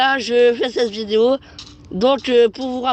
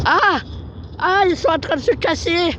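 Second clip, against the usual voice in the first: surprised; 7 semitones higher than usual. Real, not acted. Villagers escape, startled.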